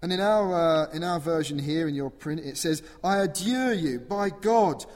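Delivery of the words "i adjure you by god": loud, high plea, slowing at the end; pleading shout. Acted. Possessed man begging Jesus not to torment him, loud shout. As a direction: beg loudly not to be tormented. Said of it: "he shouts that out".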